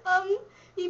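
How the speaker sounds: giggling through the words, slow; words squeezed out between laughs; laughing while talking. Real, not acted. Giggling about Martin Clunes.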